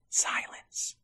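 hushed dramatic whisper. A narrator's reading. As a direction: breathe it out in suspense. Suspenseful narration.

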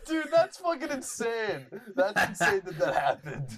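laughing through it, pitched high; laughing in disbelief. Real, not acted. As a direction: crack up while saying it. Laughing at friend's joke.

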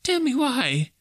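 high, timid Scrooge voice; trembling. A narrator's reading. Scrooge asking about the ghost's chains, trembling high voice. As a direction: say it fearfully, pitched right up.

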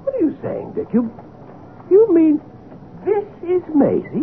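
high, incredulous questioning; halting, high-pitched amazement; astonished. Acted. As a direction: question him in sheer disbelief. Friend stunned by supposed Maisie.

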